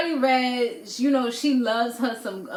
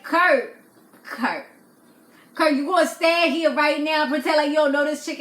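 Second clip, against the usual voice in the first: loud, fast, higher confronting Kurt; exasperated call-out; 5 semitones higher, 5 dB louder than usual. Retold or imitated. Vlogger yelling at Kurt for pretending not to know her, loud exasperated call-out.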